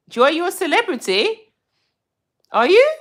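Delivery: playful disbelief; teasing astonishment at a viewer; surprised. Real, not acted. Viewer claims celebrity.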